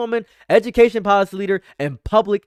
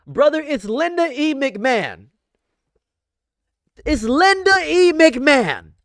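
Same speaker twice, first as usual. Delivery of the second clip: getting heated; 6 semitones higher than usual. Real, not acted. Streamer ranting to chat, worked up and heated. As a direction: hammer the name home, fired up. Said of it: "I'm getting heated".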